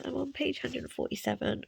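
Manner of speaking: normal talking voice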